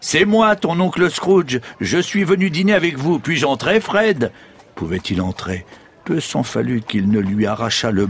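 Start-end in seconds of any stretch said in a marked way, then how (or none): 0.0-4.3 high-pitched, cheerful calling out; eager arrival. A narrator's reading. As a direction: announce yourself eagerly at the door. Scrooge calls at his nephew's door. (arrivée enjouée, voix haute)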